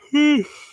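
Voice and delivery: big tired yawn. Real, not acted. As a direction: yawn out loud, worn out. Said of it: "sorry for yawning".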